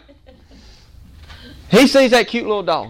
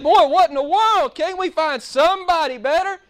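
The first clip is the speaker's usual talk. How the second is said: exasperated viewer; 9 semitones higher than usual. Retold or imitated. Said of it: "But you think".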